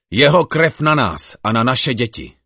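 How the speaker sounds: high, loud crowd cry; the whole people cry out together; crowd cries out. Acted. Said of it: "A všechen lid zvolal". (hlasité zvolání lidu)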